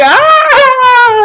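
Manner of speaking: high, loud shriek; mock pained scream. Retelling door closing on blind woman, mock pained scream. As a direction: imitate a startled scream of pain.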